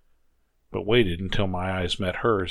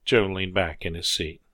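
ordinary delivery, nothing marked

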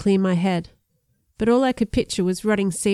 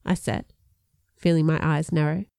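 ordinary delivery, nothing marked

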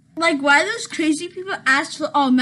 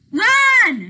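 loud high yell; shout; 7 semitones higher, 11 dB louder than usual. Acted. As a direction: bellow the command. Villain attacks, shouting at them.

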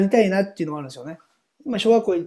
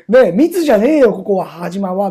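higher, louder joking retort; mock angry protest; 5 semitones higher, 10 dB louder than usual. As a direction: protest it loud, half laughing. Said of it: "みたいな". (冗談めかして怒る声)

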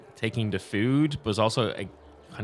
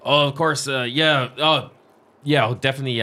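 flustered innkeeper; 4 semitones higher, 7 dB louder than usual. Acted. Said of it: "he's like".

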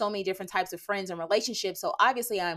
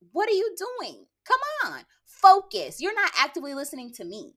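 exasperated scolding; 10 semitones higher than usual. Acted. Scolding distracted listeners.

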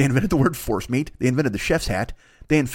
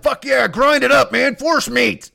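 much higher, louder, slower triumphant cry; excited caveman; 10 semitones higher, 5 dB louder, slower than usual. Acted. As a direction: shout it with giddy triumph. Oog celebrates his idea.

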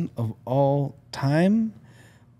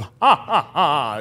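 mock Dracula laugh; 3 semitones higher, 4 dB louder, slower than usual. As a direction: ham up a Dracula cackle. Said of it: "Dracula in the back going".

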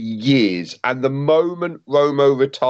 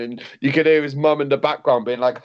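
usual speaking voice

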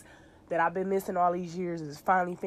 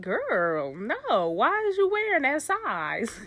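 sassy high voice; 7 semitones higher, much slower than usual. Retold or imitated. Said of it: "she like".

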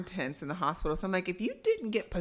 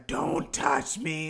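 mock demon voice; 3 semitones lower, much slower than usual. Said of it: "it would have been that voice for me".

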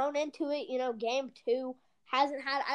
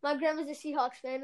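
usual speaking voice

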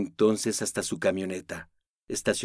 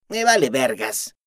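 dismissive; 3 semitones higher, 4 dB louder than usual. Acted. Aris dismisses Noemí.

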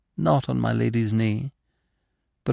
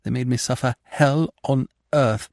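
ordinary delivery, nothing marked

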